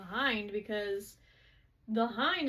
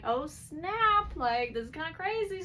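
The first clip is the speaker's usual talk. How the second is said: higher, louder mock amazement; excited retold reaction; 6 semitones higher, 6 dB louder than usual. Friend reacts to a fight breaking out, excited retold reaction. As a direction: playfully act out being impressed.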